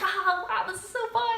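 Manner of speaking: high, bubbly imitation of the date; mock giddy. Mimicking the date swinging, high and giddy. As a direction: ham up his glee on the swings. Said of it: "He's like".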